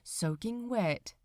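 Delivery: plain reading voice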